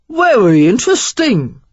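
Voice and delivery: a Cockney stranger startles Sarah, loud Cockney exclamation; Cockney accent. A narrator's reading. Stranger surprises Sarah in the card room, loud Cockney accent. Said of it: "There was a healthy dose of Cockney in his accent".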